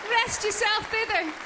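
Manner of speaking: high, drawn-out beckoning call; talking stomach. Acted. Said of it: "your stomach's going".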